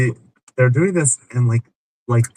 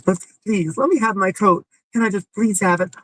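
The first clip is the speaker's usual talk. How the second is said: high, whiny begging voice; mock pleading girlfriend; 9 semitones higher than usual. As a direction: plead for the coat in a high whine. Woman begging for her coat.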